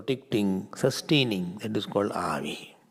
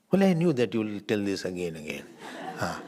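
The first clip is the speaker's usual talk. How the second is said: usual speaking voice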